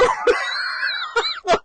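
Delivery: high, wheezing laugh; squealing laugh. Real, not acted. Laughing at how grandpa spoiled his daughter, high squeal.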